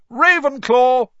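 high, booming announcement; loud shout. A narrator's reading. Sorting hat announcing a student's house, high loud shout. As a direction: shout it out for the whole hall. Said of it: "shouted the hat".

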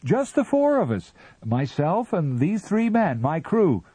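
high and loud; eager exclaiming. A narrator's reading. Captain pleads to the Martian, high and loud. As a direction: press the point eagerly.

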